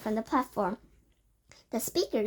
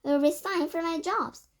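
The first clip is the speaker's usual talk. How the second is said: high, eager cry; 6 semitones higher, 4 dB louder than usual. A narrator's reading. Witches promising their leader, high and eager. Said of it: "they cried".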